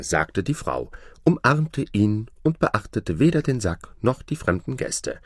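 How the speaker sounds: ordinary delivery, nothing marked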